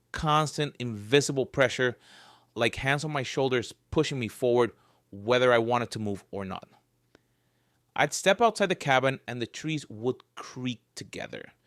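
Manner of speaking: ordinary delivery, nothing marked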